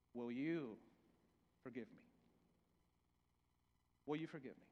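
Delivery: voicing a hard apology to a friend, hushed; soft plea. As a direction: murmur it as a humble plea. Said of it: "hardest words we can say".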